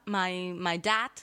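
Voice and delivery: ordinary talk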